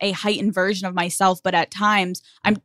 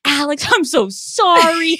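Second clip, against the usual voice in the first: put-on pleading boyfriend; 7 semitones higher, slower than usual. Boyfriend apologizing.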